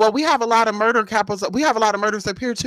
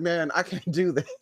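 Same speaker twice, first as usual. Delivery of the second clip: softer, muttered despair; quiet despair; 4 semitones lower, 8 dB quieter than usual. Retold or imitated. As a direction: mumble it to yourself, worn out. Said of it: "I said".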